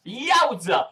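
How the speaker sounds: high, loud yowza; a real thrilled whoop; excited yelp. Real, not acted. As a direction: burst out in delight. Excited exclamation.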